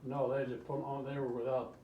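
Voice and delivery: usual speaking voice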